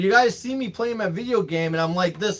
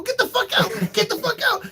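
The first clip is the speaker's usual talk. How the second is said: high-pitched, desperate retold shout; frantic yelling. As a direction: act out yelling someone out of the bathroom. Acting yelling at daughter in bathroom.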